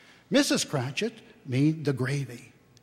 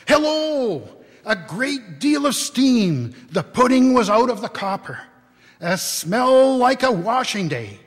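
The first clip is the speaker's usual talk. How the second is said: excited; 6 semitones higher, 7 dB louder than usual. A narrator's reading. Narrator thrilled as the pudding arrives.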